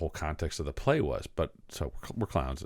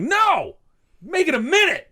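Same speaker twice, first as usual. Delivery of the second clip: put-on much higher, louder little-kid yell; child yelling back; 4 dB louder, slower than usual. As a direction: shout it like a bossy five-year-old. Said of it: "I yell back".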